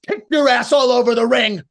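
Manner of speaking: high, loud battle yell; crescendo punchline. Shown as a demonstration. Coach showing a wrestler the big moment, loud yell. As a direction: hit the punchline at full force. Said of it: "build to a crescendo".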